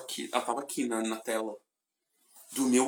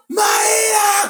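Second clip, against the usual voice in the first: high shouted name, squealing fan; fan scream; 13 semitones higher than usual. Retold or imitated. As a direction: shriek her name like a starstruck fan. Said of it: "começou a gritar". (grito de fã, agudo e alto)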